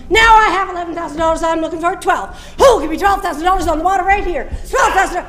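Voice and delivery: rapid auctioneer patter. Acted. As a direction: rattle off bids like an auctioneer. Auction sales pitch.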